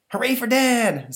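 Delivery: high, loud, slow cheer; cheering kid. Acted. Stanley cheering his father from outside, high and loud. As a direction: cheer your dad on.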